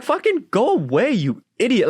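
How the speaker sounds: high, exasperated mock outburst; exasperated retort. Dog owner retells snapping at pushy strangers, high exasperated outburst. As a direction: act out telling off a pest.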